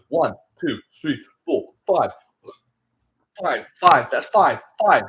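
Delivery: loud punched counting; mock loud count. Mocking creators, shouting numbers at the camera. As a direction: bark out each number.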